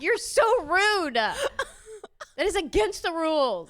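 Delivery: wife scolding husband, mock angry. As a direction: tell him off half-laughing. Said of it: "I was like".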